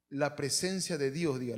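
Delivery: ordinary talk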